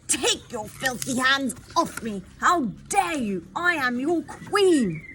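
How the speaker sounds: loud, high, indignant yell at the guards; furious shout. Acted. Queen yelling at guards dragging her, furious shout. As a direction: roar it out as an outraged queen. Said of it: "He could hear a struggle and shouts".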